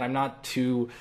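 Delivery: ordinary delivery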